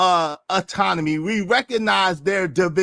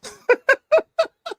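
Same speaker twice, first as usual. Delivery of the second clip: high laugh; 4 dB louder than usual. Real, not acted. Reacting to diabolical joke.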